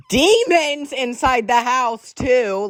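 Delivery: high, slow, loud announcement; dramatic reveal. Real, not acted. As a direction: stretch it out for dramatic effect. Dramatic reveal of house demons.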